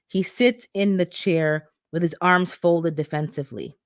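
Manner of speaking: ordinary delivery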